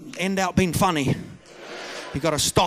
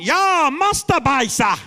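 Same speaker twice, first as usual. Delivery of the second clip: high, slow mock proclamation; hammed-up public declaration; proud announcement; 9 semitones higher, 4 dB louder, slower than usual. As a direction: stand up and call it out to the whole restaurant. Imitates diner standing up and announcing.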